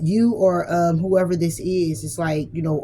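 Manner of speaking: ordinary talk, nothing marked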